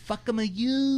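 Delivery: hammed-up fake Turkish accent, high. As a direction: repeat the bad Turkish accent. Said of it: "I don't know how to do a Turkish voice".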